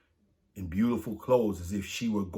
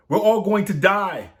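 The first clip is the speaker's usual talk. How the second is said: high, loud panicked wail; panicked cry; 12 semitones higher, 12 dB louder than usual. Acted. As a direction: scream it in terror at the gates. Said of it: "the people of Selene cried".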